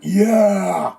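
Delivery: the whole bus backing him up; crowd shout of agreement. Retold or imitated. Passengers confirming the harassment to the cops, loud shout.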